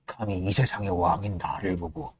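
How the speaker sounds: hushed, muttered disbelief; seething whisper. Retold or imitated. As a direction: whisper it as rage builds inside. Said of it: "그런 단편적인 어떤 증오성 말들만 들리는 거예요".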